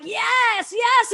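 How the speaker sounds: high, eager yes yes; excited agreement. Retold or imitated. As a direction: shout yes from the back of the room. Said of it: "now I'm like".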